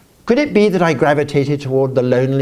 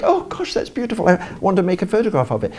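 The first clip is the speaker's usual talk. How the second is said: much higher, gasping with delight at the view; passionate wonder; 7 semitones higher than usual. Retold or imitated. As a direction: let the awe burst out high. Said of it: "I'm getting all passionate".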